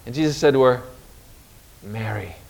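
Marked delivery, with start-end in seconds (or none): 1.8-2.4 very soft, low, gentle naming; a hushed, tender "Mary"; tender name. Acted. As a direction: say her name softly, as a loving reveal. Risen Jesus calls Mary by name.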